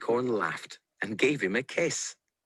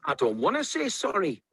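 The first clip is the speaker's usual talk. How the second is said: defiant refusal in a higher voice; mock shout, raised in pitch but not volume; mild storybook shout; 8 semitones higher than usual. A narrator's reading. Tomato defies Onion before running away, mildly shouting. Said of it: "shouted Tomato".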